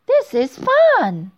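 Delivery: high, loud, drawn-out glee; thrilled little girl's exclamation; delighted. Acted. Thumbelina delighted, flying on bird.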